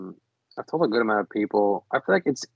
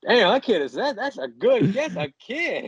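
impressed nurse; 11 semitones higher, slower than usual. Retold or imitated. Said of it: "They always be like".

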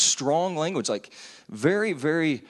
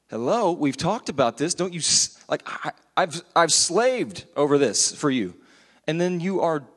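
raised, emphatic mock frustration; acted exasperation; 5 semitones higher, 4 dB louder than usual. Mentor to someone who squandered their investment, acted exasperation. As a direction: play someone fed up after wasted effort.

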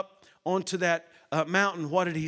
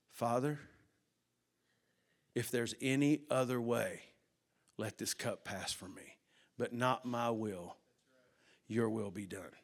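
hushed prayer; 6 semitones lower, 8 dB quieter than usual. Acted. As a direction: pray it quietly, yielding. Jesus praying in Gethsemane.

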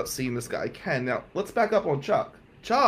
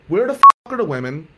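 exasperated outburst; 3 semitones higher, 12 dB louder, faster than usual. Retold or imitated. Exasperated friend spells out situation.

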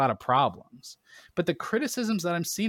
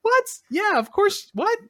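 much higher, loud exasperated what-the-hell; incredulous disbelief; 8 semitones higher, 3 dB louder than usual. Retold or imitated. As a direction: act like it's the most obvious thing ever. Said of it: "It's like".

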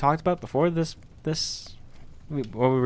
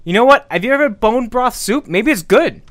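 high, loud eager pitch; suddenly thrilled; excited idea; 8 semitones higher, 8 dB louder than usual. Acted.

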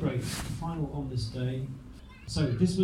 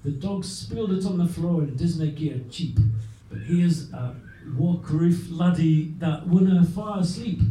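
louder, slow Scots verse; poem reading in Scots; 2 semitones higher, 4 dB louder than usual. Acted. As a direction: call out the rhyme in Scots.

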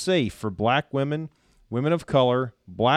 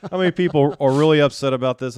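louder, laughing as he talks; can't keep a straight face reading it; words broken up by real laughter; laughing through words; 4 semitones higher, 7 dB louder, faster than usual. Real, not acted.

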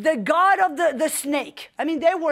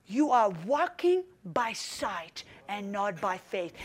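soft and slow, gently correcting; a quiet, slow inner voice; still small voice; 6 semitones lower, 7 dB quieter, slower than usual. Retold or imitated. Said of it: "the still small voice of the Spirit".

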